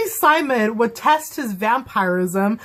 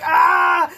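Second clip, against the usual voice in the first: fangirl shriek; 8 semitones higher, 4 dB louder than usual. Real, not acted.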